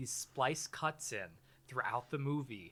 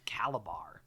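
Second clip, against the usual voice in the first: ominous whisper; 6 semitones lower than usual. Acted. Villain reveal with ominous sting.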